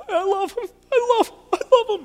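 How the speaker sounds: high, loud, imitated sobbing cry of love; imitating tearful crying. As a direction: echo the old man's tearful cry, pitched high and loud. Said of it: "he would just start crying".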